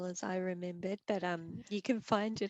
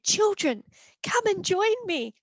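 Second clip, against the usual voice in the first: high and loud, rallying; excited mother mouse; 10 semitones higher, 10 dB louder than usual. Acted. Mother mouse calling her children to bark, high and loud. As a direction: call the children to join in. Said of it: "She said".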